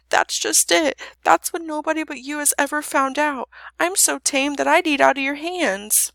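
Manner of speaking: high, loud pleading dragon voice; eager, weepy creature, acted; tearful tame dragon. A narrator's reading. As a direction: pour it out as a misunderstood dragon.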